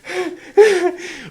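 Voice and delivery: high burst of nervous laughter; high helpless laugh. Real, not acted. Narrator after the fight, laughing nervously. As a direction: laugh out the stress. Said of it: "Je pleure de rire".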